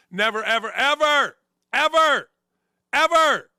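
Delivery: escalating shout; building emphasis. Real, not acted. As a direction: climb to a shout on every "ever". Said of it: "building repetition".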